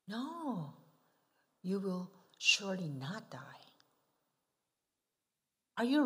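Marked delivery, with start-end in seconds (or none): 1.6-3.6 sly tempting whisper; soft hissing temptation whisper; serpent whisper. Shown as a demonstration. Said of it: "Which sounds better to you?".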